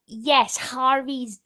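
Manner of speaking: ordinary delivery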